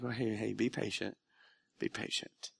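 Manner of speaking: hushed, fading to a whisper on the last "be patient"; calm him down softly; gentle voice of God, going to a whisper; soft reassurance. Retold or imitated. God's gentle reply voiced.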